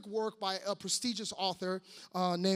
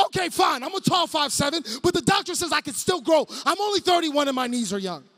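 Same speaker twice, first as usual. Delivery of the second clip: loud, higher comic protest; mock defensive outburst; 4 semitones higher, 7 dB louder than usual. Short preacher defending himself to laughing crowd, mock defensive outburst. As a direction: protest your height loudly, playing wounded.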